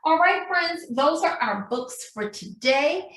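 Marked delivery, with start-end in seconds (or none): none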